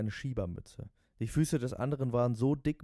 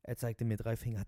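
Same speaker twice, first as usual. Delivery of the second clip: ordinary delivery, nothing marked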